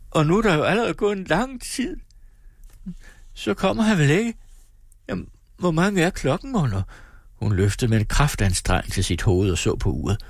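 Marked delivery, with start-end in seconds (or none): 0.1-6.8 distraught mother's voice, much higher; horrified she could sleep now; alarmed mother. Acted. Mother to daughter, shocked and distraught, high. Mother worried doctor is late.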